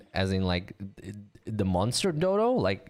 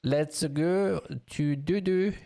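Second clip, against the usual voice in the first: "Let's go to dodo" in a put-on French accent, higher and slow; 6 semitones higher, slower than usual. Shown as a demonstration. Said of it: "with a French accent".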